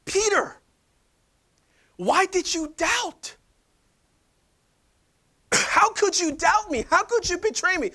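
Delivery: hammed-up Jesus scolding Peter; mock accusing Jesus. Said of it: "went into a monologue where he was Jesus".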